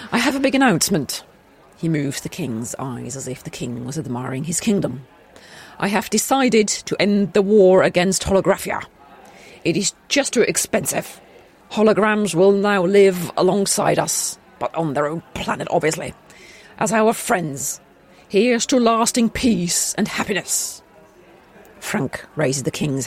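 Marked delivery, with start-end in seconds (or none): 0.1-1.2 faster, higher royal address; king voice. Shown as a demonstration. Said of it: "I'll do that bit again". 5.8-20.8 regal speech. Acted. King's speech to subjects.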